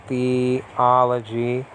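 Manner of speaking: THEOLOGY slowly as "thee ... ology"; split into parts. Shown as a demonstration. Teacher showing the two roots inside, split into parts. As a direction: break THEOLOGY apart, drawn out slowly.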